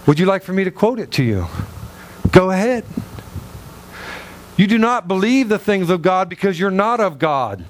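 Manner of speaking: ordinary delivery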